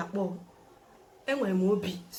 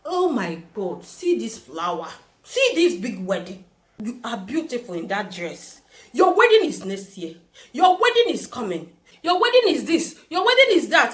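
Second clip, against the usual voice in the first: building mock prophecy; 5 dB louder than usual. Pastor announcing her wedding, building to a shout.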